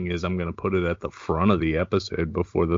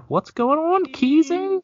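high sing-song greeting; 3 dB louder than usual. Acted. Greeting guest in put-on voice.

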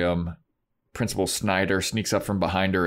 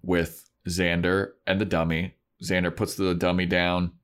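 ordinary talk

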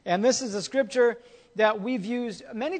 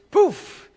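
sudden poof; 10 semitones higher, 12 dB louder than usual. Shown as a demonstration. Money vanishing.

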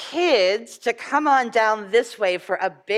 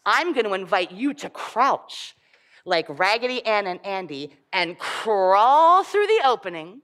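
ordinary delivery

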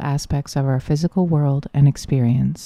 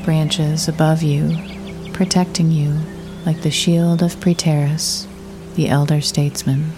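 guided meditation. Acted. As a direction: guide the listener gently into the imagery, unhurried.

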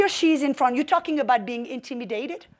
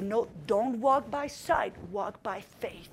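quiet self-reminder; 5 semitones lower, 8 dB quieter, slower than usual. Retold or imitated. Self-talk, resisting urge.

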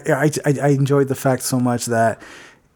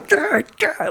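mock growl. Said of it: "she was just like".

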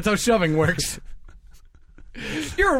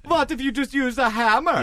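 higher, hammed-up German accent; 7 semitones higher than usual. Einstein pitches his hammer idea, comic German accent. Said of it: "say it with a German accent".

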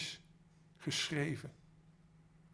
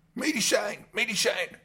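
high, loud ghostly cry; calling out; 10 semitones higher, 10 dB louder than usual. Acted. The night ghosts call for medicine, high alarmed cries. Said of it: "riepen ze door elkaar".

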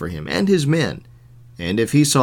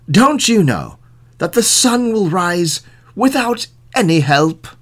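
raised, incredulous question; scolding sheriff; 6 semitones higher, 4 dB louder than usual. A narrator's reading. Sheriff correcting the old man, raised and incredulous. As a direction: push the question up and out.

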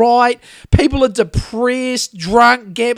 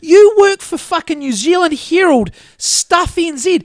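loud, high outburst; angry rant; 5 semitones higher, 5 dB louder than usual. Real, not acted. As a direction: let the anger spill out. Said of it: "this bit really sets me off".

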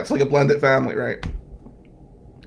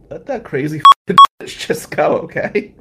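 louder, laughing aunt telling him off; blunt aunt; 2 semitones higher, 9 dB louder than usual. Retold or imitated. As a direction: laugh through it and tell him to let her go. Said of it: "Aunt Mary and Mom said".

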